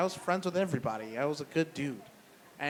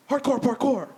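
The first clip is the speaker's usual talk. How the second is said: hyped shout; 9 semitones higher, 6 dB louder than usual. Retold or imitated. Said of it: "he was just like".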